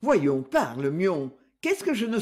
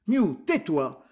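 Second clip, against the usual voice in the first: ordinary delivery, nothing marked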